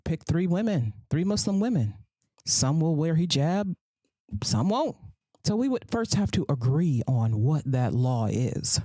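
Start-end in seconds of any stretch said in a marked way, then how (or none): none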